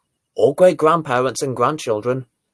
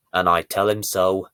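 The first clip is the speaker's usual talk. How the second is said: ordinary delivery, nothing marked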